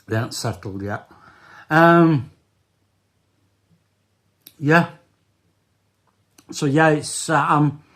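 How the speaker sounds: talking round new loose dentures. Real, not acted. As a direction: speak with dentures not settled yet. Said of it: "if I'm talking a bit funny".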